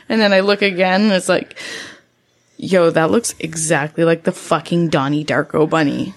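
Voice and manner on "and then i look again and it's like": ordinary talk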